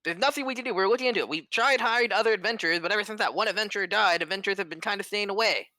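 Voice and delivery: a harried mayor babbling excuses, fast at the end; flustered town lord. Acted. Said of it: "he's like".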